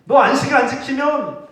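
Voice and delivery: high, loud, half-laughing threat; mock threat. Preacher threatening a Sabbath breaker, hammed-up threat. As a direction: drag out the hell warning with a laugh. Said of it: "라고 협박하지 않으셨다는 거예요".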